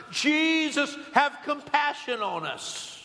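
high, drawn-out imitated cry for pity; insistent retold plea, pitched high and slowing. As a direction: stretch out the name, calling over a crowd. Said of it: "they probably got louder and louder".

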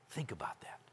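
very soft, whispered reflection; reflective whisper. Acted. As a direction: whisper it like a private thought. Acts out David stopping to reflect.

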